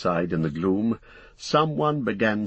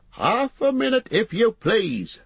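high-pitched busy landlord calling out; shout over the shoulder; 13 semitones higher, 4 dB louder than usual. A narrator's reading. Busy innkeeper to a waiting guest, shouting over his shoulder. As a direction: call back in a high, raised voice, slowing at the end. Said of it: "shouted the man over his shoulder".

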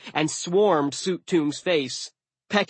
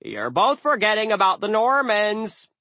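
high, loud crow voice; insistent high bird character; cawing crow; 9 semitones higher, 5 dB louder than usual. A narrator's reading. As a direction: butt in as a pedantic crow. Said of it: "A third crow cawed".

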